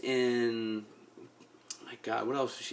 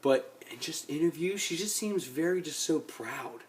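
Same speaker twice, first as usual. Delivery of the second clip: normal talking voice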